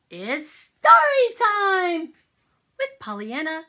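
put-on children's show host announcing; excited announcement. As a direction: proclaim it like a big treat. Announcing story time.

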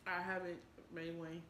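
pausing to chew between words; chewing while talking. Real, not acted. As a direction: mouth partly full. Seen 1: Eats snack before; closed, bulging chewing mouth at 1.9-3.1 s.

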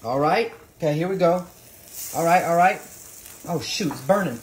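ordinary delivery

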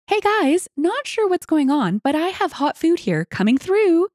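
high-pitched, cheery-polite server voice; server calling out. A narrator's reading. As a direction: sing out a warning as you squeeze past. Server squeezing past with hot food.

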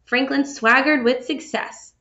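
plain reading voice

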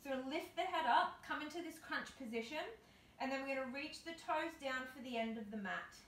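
normal talking voice

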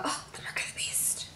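hushed, breathless whisper; whispering like she's seen something holy; awed whisper. Retold or imitated. Awed reaction to the 666 mark.

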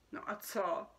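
dismissive little snort of a question; scornful. Acted. Boy answering the amazed creature, scornful. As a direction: brush it off with disdain. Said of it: "odfrkl si pohrdavě chlapec".

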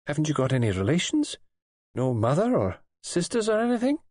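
questioning nurse, climbing high at the end; concerned nurse. A narrator's reading. Nurse's voice in the story.

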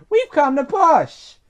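high, loud, slow announcement; lightly voiced helpful driver; announcing help. A narrator's reading. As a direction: call out an offer of help.

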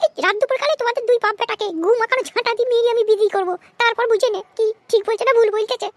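high-pitched, irritated mum; high put-on scolding voice; threatening mother. Skit mother threatens both.

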